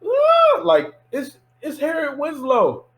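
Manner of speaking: high-pitched mock fan squeal; loud squeal into gushing, high voice; fanboying. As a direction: imitate a starstruck crowd spotting a celebrity. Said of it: "Everybody at that point was fanboying".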